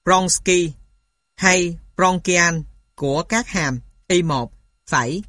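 ordinary talk

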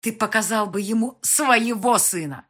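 СВОЕГО leaned on, loud and scornful; stress on СВОЕГО. A narrator's reading. Mother mocking his claim, heavy stress on СВОЕГО. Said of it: "сделала упор на слове «своего»".

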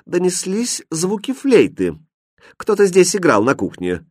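high-pitched, lofty court lady; haughty. A narrator's reading. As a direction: state it high and disdainfully. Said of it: "надменно начала придворная дама". (надменно, высоким голосом)

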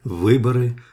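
ordinary delivery

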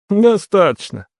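satisfied. A narrator's reading. Brother satisfied by husband's answer, contented. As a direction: end the test, content, pitch lifted. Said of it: "удовлетворённо заметил Дияр".

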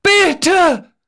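high, desperate scream; pleading at screaming pitch; screamed plea. A narrator's reading. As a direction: cry out a long plea. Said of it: "schreit Geißler".

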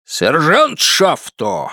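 slow, drawn-out drill-sergeant bark; barking marine. A narrator's reading. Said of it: "гаркает Рот ... изображая морпеха". (гаркающий морпех)

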